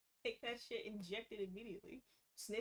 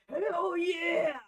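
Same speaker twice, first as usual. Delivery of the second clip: high, loud triumphant yell; gleeful yell; 8 semitones higher, 14 dB louder than usual. Acted. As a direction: roar it in triumph. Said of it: "That's how she says it".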